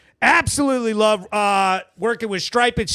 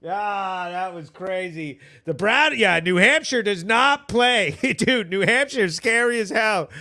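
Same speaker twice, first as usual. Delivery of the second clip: usual speaking voice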